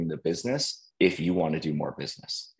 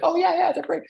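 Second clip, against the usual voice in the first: much higher, louder eager yes; eager reply; 4 dB louder, faster than usual. Retold or imitated. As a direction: gush like flattered to be asked. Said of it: "And I'm like".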